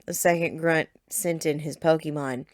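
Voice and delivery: usual speaking voice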